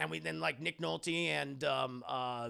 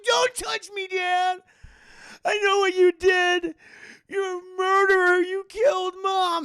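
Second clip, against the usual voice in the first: much higher and louder, yelling accusations; angry scream; 11 dB louder than usual. Retold or imitated. Son screams at his father for killing Mom, high furious yell. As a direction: scream it at him in fury.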